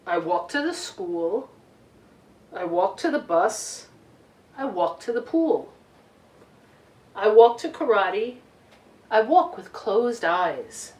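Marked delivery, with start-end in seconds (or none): none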